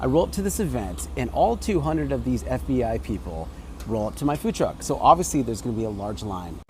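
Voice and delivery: ordinary talk, nothing marked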